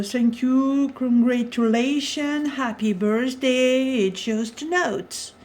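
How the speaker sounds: slow English phrases in a French accent. Shown as a demonstration. Vlogger reads English stamp phrases to viewers, French-accented English. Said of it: "mon accent".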